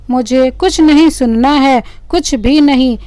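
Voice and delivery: plain reading voice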